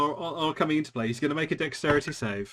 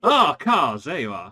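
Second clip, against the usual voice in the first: henchman greeting; 9 dB louder than usual. Acted. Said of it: "henchman greets disguised player".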